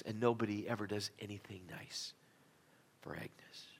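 hushed, unvoiced confidence; whisper. A narrator's reading. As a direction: breathe it out like a secret. Wife's tender aside about Agnes.

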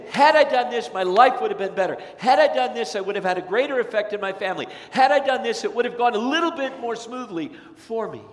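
emphatic "Had I done this" in regret; looking back, wishing things had gone differently; regretful lament. Retold or imitated. Regretful person quoting themselves.

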